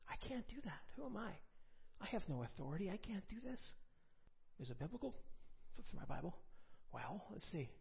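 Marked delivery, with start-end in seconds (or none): 0.1-3.6 timid protest. Retold or imitated. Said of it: "And I was like".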